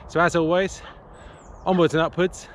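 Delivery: bright, lifted goodbye; cheerful sign-off. Real, not acted. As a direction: end on a high, upbeat note. Upbeat video sign-off.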